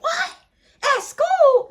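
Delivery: high shocked question; shocked man. Retold or imitated. Alex reacting to the news, high and shocked. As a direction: squeak it out as the stunned boyfriend. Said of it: "He like".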